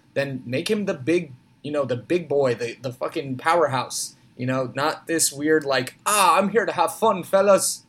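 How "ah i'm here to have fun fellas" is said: higher-pitched, jolly Irish brawler act; Sheamus impression. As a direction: play a weirdly cheery wrestler out for fun. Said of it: "with an impression that good".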